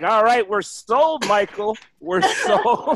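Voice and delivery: slow, laughing eager agreement; sold executives. Pretend. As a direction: give in with glee. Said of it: "They're like".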